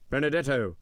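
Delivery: exclaimed call. A narrator's reading. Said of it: "exclaimed an inspector".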